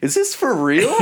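high incredulous squeak; disbelief. Retold or imitated. Disbelief at band's break.

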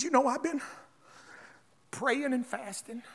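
hushed, over-pious confession sinking to a whisper; mock tearfully spiritual. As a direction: play it all spiritual, lips quivering. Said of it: "I'm all spiritual, my lips quivering".

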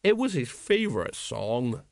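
slow, maudlin remembrance; sad. A narrator's reading. Tipsy sergeant remembering a dead comrade, sadly. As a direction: say it slowly, mourning a mate. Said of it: "said Colon sadly".

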